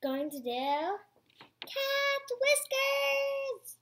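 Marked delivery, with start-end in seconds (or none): 1.6-3.8 high, slow sing-song announcement of the figure's name; grand, drawn-out announcement; drawn-out title call. Real, not acted. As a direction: stretch the name out like a show title. Announcing the next string figure.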